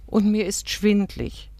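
understated weary plaint; sighed complaint. A narrator's reading. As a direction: sigh it out, a touch plaintive. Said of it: "seufzte Rahel".

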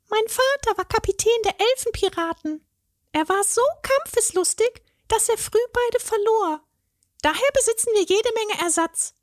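very high fairy voice, a little sad; a fairy telling of her pirate father; wistful fairy. A narrator's reading. The fairy tells the troll about her father, high and wistful.

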